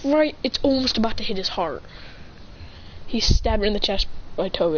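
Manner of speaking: ordinary delivery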